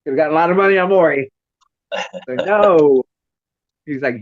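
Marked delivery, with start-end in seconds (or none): 0.0-1.3 high, loud mock accusation; playful loud jab; teasing. Teasing the sons. 2.5-3.0 firm no. Retold or imitated. Son's firm denial voiced.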